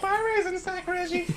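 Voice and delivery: very high, soft falsetto; mocking girlish singsong; teasing girls' team. Girls' team mocking the shriveled diver, girlish falsetto.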